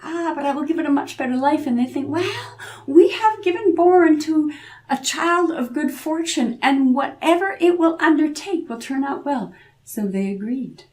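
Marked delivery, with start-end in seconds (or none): 0.0-1.7 quick, higher put-on voice of the king; king's voice. King voiced in told tale. 2.1-9.5 higher, hopeful parents' voice; proud parents. Acted. Parents voiced in told tale.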